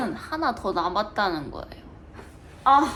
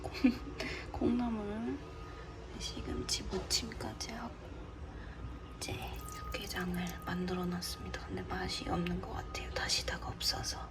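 whispered cooking vlog narration; hushed so he won't wake; whispering; 3 semitones lower, 14 dB quieter than usual. Real, not acted. (몰래 속삭이는 목소리)

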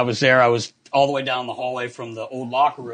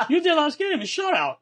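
much higher, playful imitation of the goalie; high goofy voice. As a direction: chirp it up high like a kid. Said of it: "He goes".

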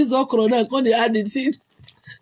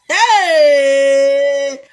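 long, loud, high "hey"; loud whoop; 5 semitones higher, 8 dB louder than usual. Real, not acted. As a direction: cry out and hold the sound. Exclaims at her own remark.

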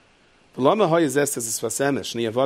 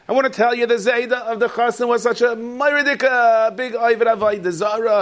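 high, loud, nasal-free sneering busybody voice; mocking gossip; 9 semitones higher, 6 dB louder than usual. Wedding guest sneers at the groom's grandfather, mocking gossip voice. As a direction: play the catty wedding gossip.

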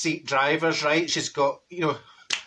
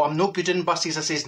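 ordinary talk, nothing marked

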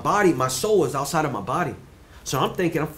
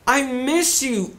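high, slow, drawn-out voice of a dead grandmother; eerie high grandma voice calling from beyond; imitating grandma; 10 semitones higher, much slower than usual. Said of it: "hear my grandma talk to me".

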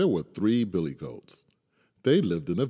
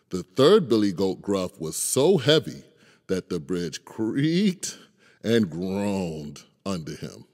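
usual speaking voice

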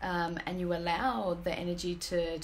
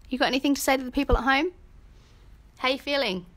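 chatting to the cat; 6 semitones higher, 7 dB louder, much faster than usual. Real, not acted. Talking to the cat on camera.

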